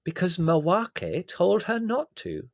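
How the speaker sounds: high, loud, slow pronouncement; triumphant. A narrator's reading. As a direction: deliver it as a triumphant revelation. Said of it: "said Prue triumphantly".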